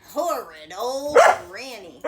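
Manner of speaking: loud grumbling curse; angry cry. A narrator's reading. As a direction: spit it out sore. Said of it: "the wolf cried".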